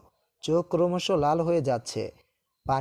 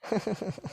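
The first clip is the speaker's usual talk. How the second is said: quiet, higher-pitched little laugh; soft chuckle; 4 semitones higher, 7 dB quieter than usual. Acted. Boyfriend amused by her childlike pleading, soft chuckle. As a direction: chuckle softly under the words to come.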